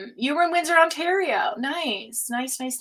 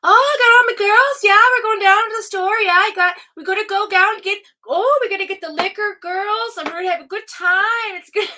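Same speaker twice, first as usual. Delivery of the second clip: put-on much higher, loud party-girl voice from Newfoundland; Tuna Tina character; 11 semitones higher, 10 dB louder than usual. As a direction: ham up a giddy Newfoundland woman heading out with her girls. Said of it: "But he says stuff like".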